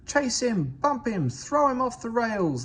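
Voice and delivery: high, loud rowdy yell; yelling trucks. A narrator's reading. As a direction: shout it like a mob giving chase. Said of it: "they yelled".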